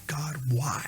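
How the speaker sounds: breathless whisper, barely voiced; whispered plea. In the car at the hospital, whispering to God. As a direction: whisper it like a broken prayer. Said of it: "I just started saying".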